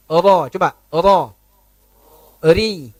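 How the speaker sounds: "ra" dan "Ri" dengan ujung lidah ringan; light ra ri demo. Shown as a demonstration. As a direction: tap "ra", "Ri" lightly with the tongue tip. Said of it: "ujung lidah kita ringan". (ujung lidah ringan pada "ra" dan "Ri")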